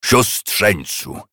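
slow stern cutting off; stern interruption. A narrator's reading. Scrooge cutting off his nephew, slow and stern. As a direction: cut him off sternly. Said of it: "surowo przerwał mu starzec".

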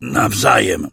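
gasping out a reply; out of breath. A narrator's reading. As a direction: squeeze the word out breathless. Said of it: "Musiałem dwa razy wciągnąć powietrze".

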